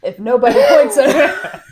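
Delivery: loud, full-chested repeat with a laugh; with your whole chest. Shown as a demonstration. Host repeating his line loudly when dared by guest. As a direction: belt it out with conviction. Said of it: "Say it with your whole chest".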